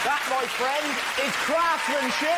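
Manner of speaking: high, emphatic proclamation, drawn out at the end; mock grand admiration. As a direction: proclaim it with hammed-up awe. Mock admiration of bridge mid-act.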